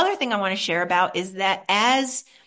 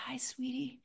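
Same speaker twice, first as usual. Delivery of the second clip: soft, hushed and tender; tender whisper to self; 2 semitones higher, 11 dB quieter than usual. Shown as a demonstration. Speaking softly to her scared inner child, a tender whisper. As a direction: whisper gently to your own heart. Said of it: "I reach for my own heart and I say".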